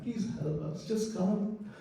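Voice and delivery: real emotion, a soft heartfelt plea to come; emotional plea. Real, not acted. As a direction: beg them quietly, moved almost to tears. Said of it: "I'm very emotional about this".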